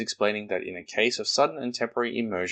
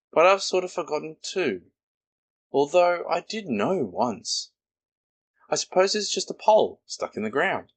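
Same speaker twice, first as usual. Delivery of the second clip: higher, fussy little Rabbit voice; brisk Rabbit, higher pitched; 9 semitones higher than usual. Acted. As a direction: play Rabbit puzzled and busy. Rabbit's voice in story.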